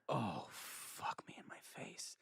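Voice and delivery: slow, breathy whisper of panic; mortified whisper. Retold or imitated. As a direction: hush it like a private curse. His own embarrassed inner reaction.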